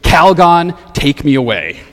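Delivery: loud first word, then dropping back to talk; toned-down slogan, punched only on the name; quoted catchphrase. Retold or imitated. Preacher quotes an old commercial's slogan to the congregation, lightly punched. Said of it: "with the catchphrase".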